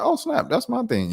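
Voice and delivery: high excited oh snap; retold surprise. Host recalling seeing his clip go viral, high and excited. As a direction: pop up in delighted surprise. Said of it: "I was like".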